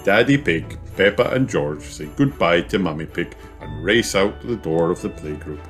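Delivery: ordinary delivery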